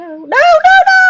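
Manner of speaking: high, loud panicked cry. Acted. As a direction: cry out as you fall. Game character falls off climb.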